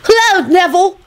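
put-on high-pitched little-boy greeting; beaming. A narrator's reading. Teddy greets Neville happily, high beaming voice. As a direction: greet him with childish delight. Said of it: "he beamed".

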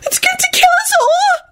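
very high tearful wail; blubbering. A narrator's reading. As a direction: blubber it in terror. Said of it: "Serena blubbered".

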